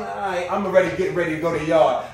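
ordinary delivery

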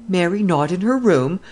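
high, alarmed outcry; alarmed cry. A narrator's reading. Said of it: "he cried".

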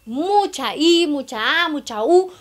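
"mucha I", "mucha A", "mucha U" with each vowel stretched and resonant; exaggerated vowel drill. Shown as a demonstration. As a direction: play with big resonant vowels. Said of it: "muy exagerado, que resuene y jugando".

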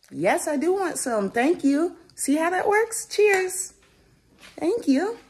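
high, bright praise voice; excited praise. Real, not acted. Mom praising her son for sharing, excited and high.

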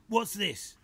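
a gruff badger grabbing the card. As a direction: bark the question like a tough badger. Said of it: "asked gruffly".

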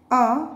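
"a" said uh, as in under; short U sound. Shown as a demonstration. Teacher shows learners short U, "a" said uh. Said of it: "The short sound of U".

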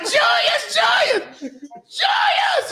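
high shrieked name, rising; screaming crowd. Retold or imitated. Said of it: "they was like".

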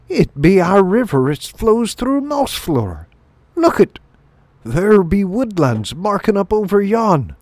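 high, rustic country mole voice; rustic mole, astonished. Acted. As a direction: marvel at it in high mole dialect.

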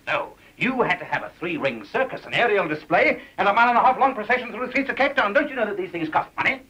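building, high-pitched tirade; exasperated scolding. Acted. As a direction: reel off the follies in rising outrage. Boss scolds employee over costly stunts.

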